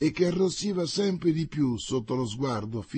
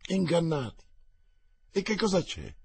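choked alarm; 7 semitones higher than usual. Acted. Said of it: "esclamò Enrichetta poco meno che soffocata".

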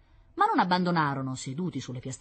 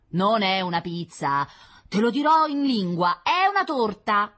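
loud, slow, rising insistence; emphatic contradiction; 7 semitones higher, 7 dB louder than usual. Acted. Child insisting to sibling on what it is, emphatic. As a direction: spell it out forcefully. Children's quarrel over the object.

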